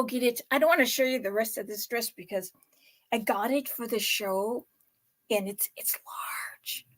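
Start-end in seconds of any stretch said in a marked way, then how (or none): 5.3-6.9 whispered aside. Real, not acted. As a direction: whisper it like a secret. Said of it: "confiding whisper about dress".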